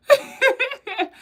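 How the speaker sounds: loud, high burst of laughter; high delighted laugh. Real, not acted. Host laughing at a viewer's message, loud high laugh. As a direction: crack up with glee.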